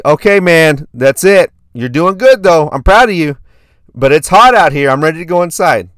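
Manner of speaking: retold fed-up but supportive dad; feisty tired dad. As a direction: urge him to call it quits. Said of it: "I'm getting feisty".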